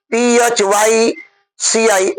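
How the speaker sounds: letters पीएचवाईसीआई spelled one by one, slow; spelling it out. Shown as a demonstration. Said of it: "वो पीएच". (धीरे-धीरे अक्षर बोलते हुए)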